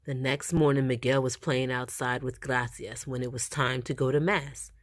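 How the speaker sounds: ordinary delivery, nothing marked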